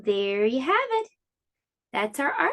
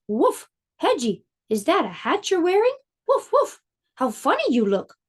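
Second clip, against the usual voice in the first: higher, barky voice with sharp woofs; barking farm dog; 4 semitones higher than usual. Acted. Farm dog teasing Hedgie about his hat, barking higher. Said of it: "She barked".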